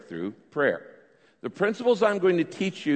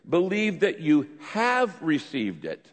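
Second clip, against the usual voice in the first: slow, deliberate weight on "have"; measured verse reading, "have" stretched and emphasized; stress on have; 2 semitones higher, slower than usual. Shown as a demonstration. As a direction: point up the tense by leaning on "have". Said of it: "Notice the change in tense here".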